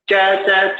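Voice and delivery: च repeated crisply as a sharp ch sound; sound demo. Shown as a demonstration. Teacher sounding out च for the class, quick and crisp. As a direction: tap out च, च to show the repeated sound. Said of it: "च की आवाज आ रही है".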